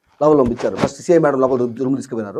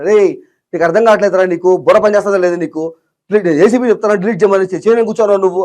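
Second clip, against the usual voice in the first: retold officer yelling, higher and louder; angry scolding; 6 semitones higher, 7 dB louder than usual. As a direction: berate him like a furious officer. Official scolding him in retelling. (కోపంగా తిట్టడం)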